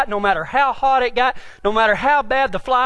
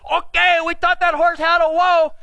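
higher, hollered announcement; triumphant holler; 4 semitones higher than usual. Retold or imitated. Partner turning round to the rider, triumphant holler. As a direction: imitate a pleased partner calling over.

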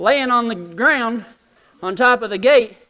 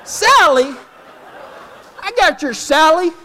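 higher, louder indignant retort; mock offended; 6 semitones higher, 3 dB louder than usual. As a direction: bristle at the nickname. Insulted by the name Sally.

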